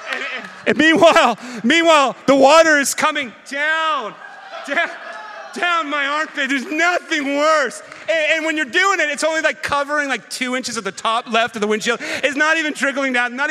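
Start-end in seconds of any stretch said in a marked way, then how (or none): 0.0-9.0 laughing. Real, not acted. Laughing retelling wet armpit.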